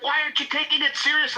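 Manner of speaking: high, exasperated imitation; losing it. Panicked caller accusing the volunteers, mock-hysterical. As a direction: plead indignantly as a worked-up caller. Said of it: "they were losing their shit".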